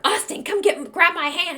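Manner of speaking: high, urgent fake alarm; acted frightened mom; mock panic. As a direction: pretend terror calling the son. Urgent mom faking fear.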